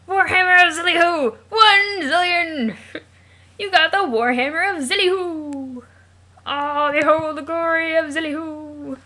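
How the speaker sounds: high, drawn-out heroic cry; triumphant proclamation. Acted. Hero receives the Warhammer of Zillyhoo, exultant proclamation. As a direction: hail it like a legendary treasure, slow and ringing.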